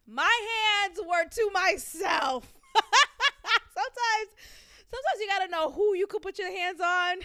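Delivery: high, loud laughter through the words; laughing. Real, not acted. Host laughing at her own joke, loud and high. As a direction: laugh it off loudly.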